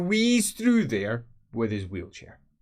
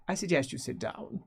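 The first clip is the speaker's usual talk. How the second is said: high, softer command; Feuerbach, cool; 6 semitones higher, 6 dB quieter than usual. Acted. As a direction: quietly put him in his place. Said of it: "Feuerbach says".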